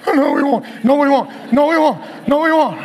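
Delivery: loud, high-pitched mock panic; hammed-up fretting, repeated in a high, loud voice; stressed-out. Husband contradicting his relaxed wife, stressed-out. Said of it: "I'm stressing".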